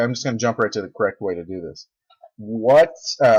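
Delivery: normal talking voice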